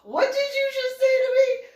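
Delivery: high, loud, indignant challenge; outraged high-pitched retort; mock temper flare. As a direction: bristle at being called boy. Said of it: "he threw a little in a temper".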